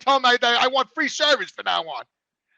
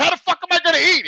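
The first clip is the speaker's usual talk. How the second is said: retold yell; 7 semitones higher, 4 dB louder, faster than usual. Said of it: "I was yelling 'cause I got upset".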